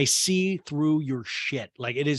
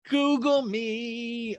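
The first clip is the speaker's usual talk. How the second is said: Google me drawn out slow and high; slow tease; 10 semitones higher, much slower than usual. Shown as a demonstration. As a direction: savour each word.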